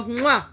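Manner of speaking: loud, high kiss noise; a big smacked "mwah"; blown kiss. Real, not acted. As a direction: send a loud kiss to the audience. Blows listeners a kiss.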